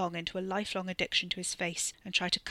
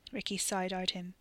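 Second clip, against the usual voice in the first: usual speaking voice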